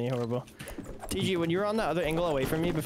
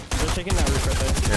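urgent callout; 7 dB louder than usual. Real, not acted.

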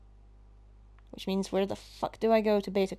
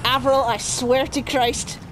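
panicked yelling; 4 semitones higher than usual. Real, not acted. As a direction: yell at a pursuer to back off. Yelling at pursuer in game.

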